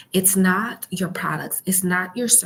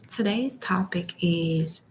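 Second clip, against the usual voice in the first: library whisper. Real, not acted. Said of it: "um, whispering".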